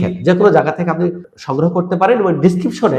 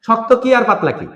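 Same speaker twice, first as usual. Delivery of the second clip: ordinary delivery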